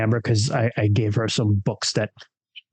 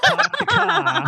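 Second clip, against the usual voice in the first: drawn-out, high mock Boston accent through laughter; much slower than usual. Joining the joke about a player's accent, hammed-up Boston. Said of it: "gonna have a thick Boston accent".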